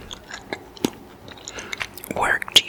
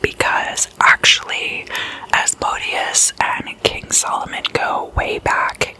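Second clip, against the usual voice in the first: ordinary delivery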